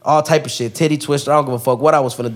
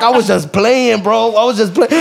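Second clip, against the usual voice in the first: mocking whine; 9 semitones higher, 4 dB louder than usual. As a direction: mimic the coward's excuse. Mocking attacker's excuse.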